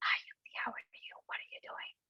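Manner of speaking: soft unvoiced whisper; whisper demo. Shown as a demonstration. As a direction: whisper it to a friend. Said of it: "So if I were to say".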